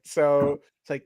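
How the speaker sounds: ordinary talk, nothing marked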